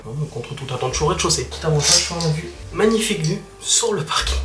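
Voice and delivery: hushed so the neighbours won't hear; quiet voice. Real, not acted. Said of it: "j'ose pas parler fort du tout".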